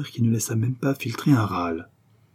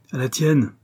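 put-on drunken toast; 4 semitones higher, 4 dB louder than usual. A narrator's reading. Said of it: "reprit Delourmeau".

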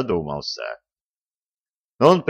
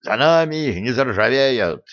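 dog yapping; 3 semitones higher than usual. A narrator's reading. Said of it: "тявкнул Жучок".

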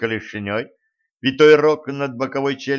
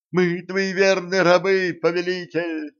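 fawning servant; 7 semitones higher than usual. A narrator's reading. Servant repeats fawning cry.

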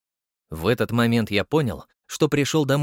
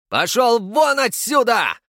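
high, loud, slow yell; shouting; 11 semitones higher, 6 dB louder, slower than usual. Acted. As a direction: throw him out at full roar. Разъярённый граф выгоняет слугу. (кричит в ярости)

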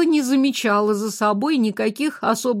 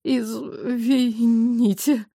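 slow strained apology; forced out; much slower than usual. A narrator's reading. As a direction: squeeze it out after coughing. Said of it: "с трудом выдавила".

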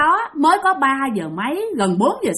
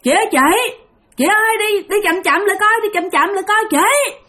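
high-pitched retold call to a stranger; calling after someone; 8 semitones higher than usual. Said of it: "Thì ổng mới kêu". (gọi với theo, giọng cao)

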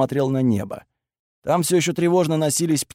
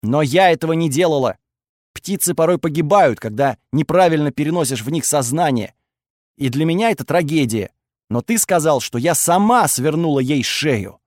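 usual speaking voice

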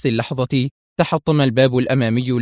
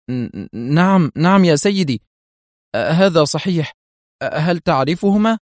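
stammering, higher and nervous; flustered stammer; 5 semitones higher, 4 dB louder than usual. A narrator's reading. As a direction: stumble over the answer. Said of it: "أجابه جوزيف بارتباك". (تلعثم مرتبك أمام رئيسه)

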